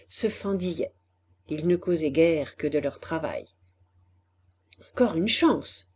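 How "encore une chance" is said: usual speaking voice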